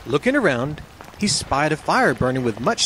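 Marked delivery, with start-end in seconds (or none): none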